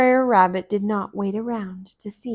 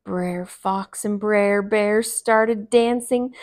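usual speaking voice

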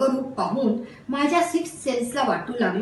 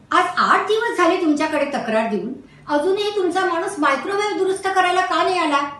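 raised, higher voice demanding why no one came; irritated complaint; 6 semitones higher, 3 dB louder than usual. Acted. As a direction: complain loudly to the shop owner. Narrator complains to shopkeeper. (चिडून केलेली तक्रार)

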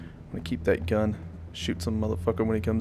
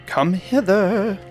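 slow, high, theatrical beckoning; mock grand summons; 8 semitones higher, much slower than usual. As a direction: draw it out like a lord summoning. Beckoning in an odd drawl.

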